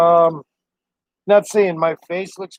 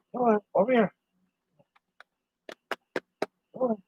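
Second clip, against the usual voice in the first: higher, coaxing calls to a pet; coaxing the dog; 4 semitones higher than usual. Real, not acted. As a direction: sweet-talk the dog up onto your lap. Coaxing dog onto his lap.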